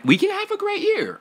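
we can have a great year, squealed high; excited. Real, not acted. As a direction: burst up into a squeal. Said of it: "repeated with growing hype".

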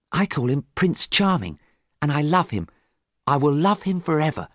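put-on high-pitched, devoted young woman; lovestruck sister. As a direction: declare love in a high voice. Voices Sybil in love.

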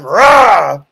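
loud mock roar; demonstrated growl. Shown as a demonstration. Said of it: "growled at them".

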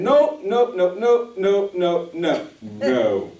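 out loud refusing, loud and falling; loud repeated no. Retold or imitated. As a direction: pound out each no, winding down. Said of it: "I was saying out loud".

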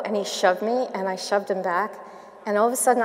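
ordinary delivery

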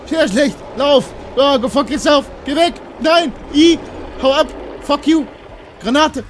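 high, loud bursts of panic; panicked screaming. Real, not acted. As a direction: yell each word in alarm. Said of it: "Hat man an deinen männlichen Schreien gehört". (panisches, hohes Schreien)

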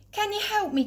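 high, eager call for help. Acted. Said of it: "called Fred".

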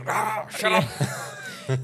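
high, drawn-out mock scream; shrill-pitched imitation of a scream, stretched out; Emily screaming. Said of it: "Emily screams two times".

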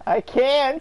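high squeaky laugh through words; laughing squeak. Real, not acted. Laughing at teammate's jab.

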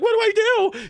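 high, loud panicked squeal. Retold or imitated. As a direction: shriek it helplessly like a scared wife. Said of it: "I felt like Karen".

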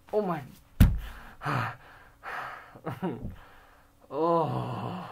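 loud gasping relief; exhausted release. Real, not acted. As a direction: let it all out after the strain. Collapse after plank ends.

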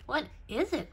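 ordinary delivery

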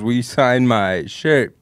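ordinary talk

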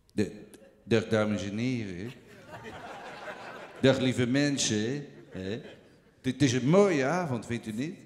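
lage, trage stem van een oudere komiek; Toon Hermans imitatie. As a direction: zak diep en traag weg als een oude cabaretier. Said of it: "ik denk ongeveer zoiets als dit". (trage, lage imitatie van een oude komiek)

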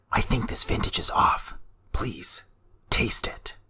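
cold, toneless whisper with a threat underneath. Acted. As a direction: breathe the words, no voice at all. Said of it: "Lannery whispered".

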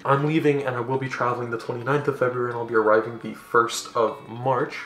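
usual speaking voice

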